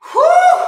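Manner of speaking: high shouted whew; loud whew of exertion. Real, not acted. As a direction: blow out hard from the effort. Instructor mid exercise, legs burning.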